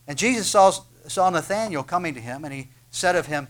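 ordinary talk

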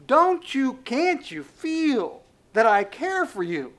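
high, loud, drawn-out plea; impassioned full-voiced appeal; crying out. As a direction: cry it out slowly, pleading to be heard. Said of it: "It is his voice that is crying out".

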